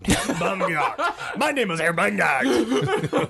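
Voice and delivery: slow, exaggerated German Herr Baumgart through laughter; practicing his accent. Shown as a demonstration. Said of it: "he had to do his own accent".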